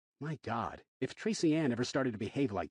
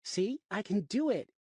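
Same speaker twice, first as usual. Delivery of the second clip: put-on higher, slow child voice, lightly done; proud little girl; 9 semitones higher than usual. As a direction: show off to daddy, slowly.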